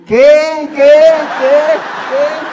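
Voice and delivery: a mock echo. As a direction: bounce it back like an echo. Echo of the stammering call.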